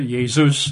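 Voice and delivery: Jesus said yay-ZOOS; Jesus in Greek, Y at the front, OOS at the end; Greek pronunciation. Shown as a demonstration. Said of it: "how they would pronounce it".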